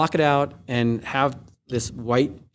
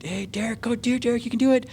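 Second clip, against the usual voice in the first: imitating crowd cheers; 11 semitones higher than usual. Retold crowd calling his name.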